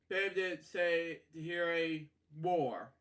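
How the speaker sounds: ordinary delivery, nothing marked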